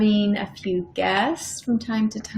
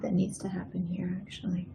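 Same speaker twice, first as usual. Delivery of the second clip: whispered aside to herself; 4 semitones lower, 12 dB quieter, faster than usual. Real, not acted. Painter whispering to herself over her canvas, whispered. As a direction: breathe it, barely voiced. Quiet note to self while painting.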